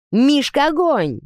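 high, loud cry of alarm; sudden shout. A narrator's reading. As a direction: shout the order to a comrade. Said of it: "крикнул вдруг кто-то". (внезапный крик)

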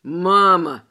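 loud, low-pitched complaint; disgruntled. A narrator's reading. Grown son calls his mother, disgruntled. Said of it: "проговорил он недовольным голосом".

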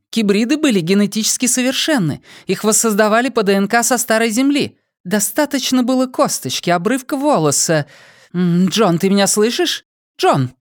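high, cool woman's voice; Dayana's voice. Acted. Dayana questioning the drugged prisoner, high and cold. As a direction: play the cold interrogator lady.

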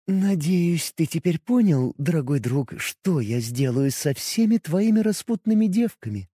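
soft, velvety menace. Acted. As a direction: purr it softly, sweetly threatening. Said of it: "бархатным голосом".

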